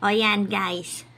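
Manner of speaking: normal talking voice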